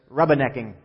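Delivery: mock Long Island accent with dropped R; lightly put-on Long Island "rubbernecking", said "rubba-necking". Pastor quoting Long Islanders on traffic, Long Island accent. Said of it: "according to Long Islanders".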